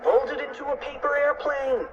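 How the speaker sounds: high-pitched, urgent order; urgent cry. A narrator's reading. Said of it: "cried Fluffy".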